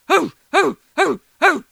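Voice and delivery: loud high woof barks; dog barking. A narrator's reading. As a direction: bark out like a dog. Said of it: "The dog barked".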